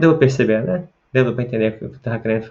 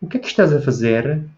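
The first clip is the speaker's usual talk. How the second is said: imitated Lisbon-style question melody; European Portuguese accent; 3 semitones higher than usual. Shown as a demonstration. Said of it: "tento imitar a pronúncia dos portugueses". (pergunta com sotaque de Portugal)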